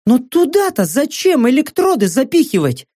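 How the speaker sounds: mocking mercenary woman. Saka's voice.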